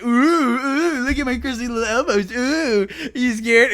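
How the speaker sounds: high, loud, fast mocking taunt; hammed-up playground teasing. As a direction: wave the elbows at her and tease.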